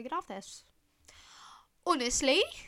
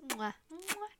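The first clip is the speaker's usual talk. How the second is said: two smacking air kisses, higher; blown kisses; 8 semitones higher, 3 dB quieter than usual. Pretend. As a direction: blow two playful kisses. Blowing kisses to listeners.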